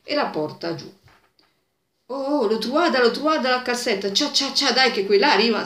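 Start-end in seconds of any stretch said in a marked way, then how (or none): 2.1-5.6 excited. Retold or imitated. Men find the stocking, excited.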